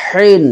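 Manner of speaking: হিন with h pressed from mid-throat; throat-squeezed H. Shown as a demonstration. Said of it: "কণ্ঠনালীর মাঝখানে চাপ দিয়ে".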